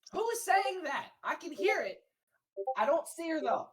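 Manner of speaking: high, loud startled question. Acted. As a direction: sound caught off guard. Character startled by hidden voice.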